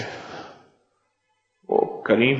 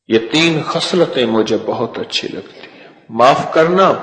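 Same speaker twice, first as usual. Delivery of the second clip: usual speaking voice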